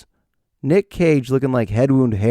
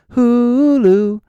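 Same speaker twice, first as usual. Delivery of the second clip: HULU drawn out high and loud; exaggerated plug; 12 semitones higher, 5 dB louder than usual. Comic plugging Hulu, exaggerated high loud call. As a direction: stretch the first syllable of HULU, pitched way up. Exaggerated repeat of the streaming name.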